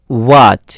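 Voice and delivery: "ওয়াচ" opened with a rounded o-glide; w as o plus bo. Shown as a demonstration. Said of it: "প্রথমে অ, তারপরে".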